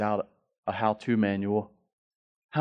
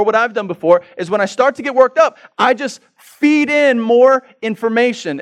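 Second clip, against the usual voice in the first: ordinary delivery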